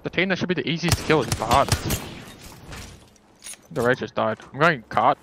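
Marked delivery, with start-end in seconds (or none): none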